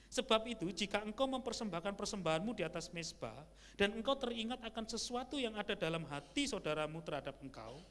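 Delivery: loud, high, ringing Bible reading; preacher reading the verse loudly to the church; scripture reading. Acted. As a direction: proclaim the verse to the congregation. Reads scripture aloud.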